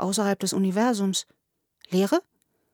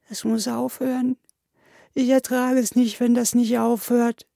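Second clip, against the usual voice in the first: higher, acted tearful plea; pitched-up weepy pleading; sobbing despair; 4 semitones higher than usual. A narrator's reading. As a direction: whimper it like a desperate child. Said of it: "Er schluchzte immer weiter".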